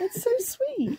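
high, sweet coo. Real, not acted. Reader defends a book for babies, cooing. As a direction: gush over it in a high voice.

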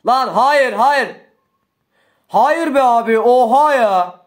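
loud, high cries of no; frustrated gamer outburst; despairing shout. Real, not acted. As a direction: yell as the game slips away. Losing the match, despair.

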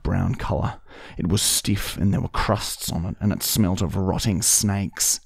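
lightly performed diary reading. Acted. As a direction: read the entry out like a deadpan letter. Reads a diary entry aloud.

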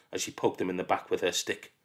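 ordinary delivery

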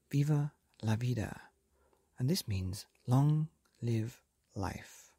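ordinary delivery

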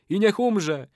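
high incanting call; ritual curse. A narrator's reading. Old man calls death on betrayer, incanting.

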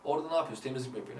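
normal talking voice

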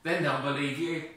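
loud, firm insistence; firm God. Acted. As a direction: insist with authority. God reassures.

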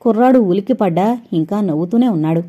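ordinary delivery, nothing marked

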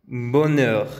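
"bonheur" with open rounded end; French bonheur. Shown as a demonstration. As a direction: say "bonheur" clearly.

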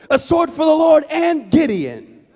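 high, drawn-out army shout; slow, full-force rallying cry; shouted battle cry. Acted.

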